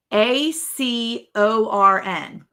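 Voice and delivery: slow, letter-by-letter spelling. Shown as a demonstration. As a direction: spell A C O R N with space between each letter. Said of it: "How do you say that".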